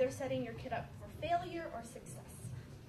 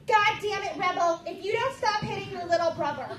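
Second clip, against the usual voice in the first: put-on loud scolding of a misbehaving kid; mock furious parent yelling at son; angry parent yell; 3 semitones higher, 9 dB louder than usual. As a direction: bellow at Rebel to stop hitting.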